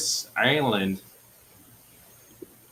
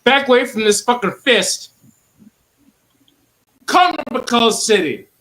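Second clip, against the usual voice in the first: angry shout; 12 semitones higher, 9 dB louder than usual. Pretend. Angry threat during argument.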